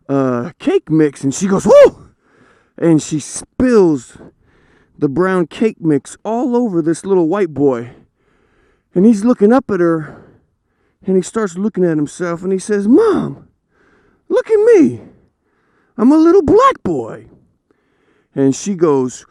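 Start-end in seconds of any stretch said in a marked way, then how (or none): 1.7-2.1 high, loud startled yelp; startled whoa. Retold or imitated. Mom startled by her sneaking son, high loud yelp. 12.9-17.3 put-on high, loud kid voice; little boy voice. Little boy showing his mom his face, high excited kid voice.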